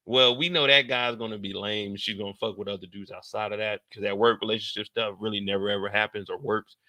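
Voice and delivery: normal talking voice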